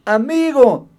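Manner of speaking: normal talking voice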